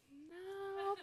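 quiet, high flat refusal; a dry unimpressed no. Retold or imitated. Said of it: "some people were like".

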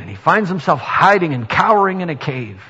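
usual speaking voice

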